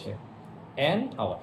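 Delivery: ordinary talk, nothing marked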